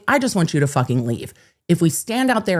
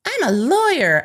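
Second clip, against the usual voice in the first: mimicking a smug man, pitched higher; mock pompous; 8 semitones higher than usual. Quoting the trespasser's boast, mock-smug and higher. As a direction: puff it up like a self-important lawyer. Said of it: "he was just like".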